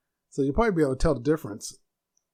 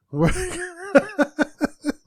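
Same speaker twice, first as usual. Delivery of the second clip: high, loud burst of laughter; helpless high laugh after a flub; real laughter; 5 dB louder than usual. Real, not acted. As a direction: crack up at your own slip. Cracks up at own stumble.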